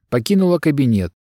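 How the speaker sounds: ordinary delivery, nothing marked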